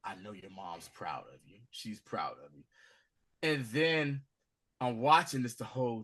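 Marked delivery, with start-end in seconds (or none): 0.0-2.6 soft, low comforting words; consoling. Retold or imitated. Friend consoling the grieving son, soft reassurance. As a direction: reassure him gently. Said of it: "Vic is like".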